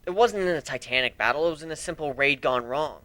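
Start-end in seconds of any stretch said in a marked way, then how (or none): none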